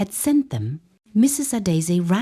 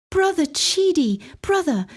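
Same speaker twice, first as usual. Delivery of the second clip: mock screaming; 9 semitones higher than usual. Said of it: "practically screaming into the phone".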